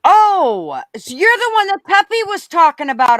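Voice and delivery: high, loud "oh!" of realization; real sudden recognition. Real, not acted. Streamer recognizes a viewer Peppy mentioned, loudly surprised. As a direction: pipe up as the penny drops.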